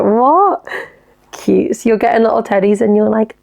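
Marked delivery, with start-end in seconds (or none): 0.0-0.7 surprised. Real, not acted. Surprised by plush voices.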